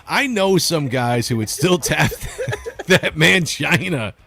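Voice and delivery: high, drawn-out, breaking into real laughter; laughing while reading. Real, not acted. Reading a crude viewer comment to co-host, cracking up. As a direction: struggle to get it out through laughs.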